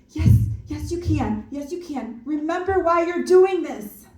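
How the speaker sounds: high, loud cheer urging a friend on; emphatic cheerleading. Acted. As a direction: shout her on like a coach from the bank. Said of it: "Emphatic cheerleading".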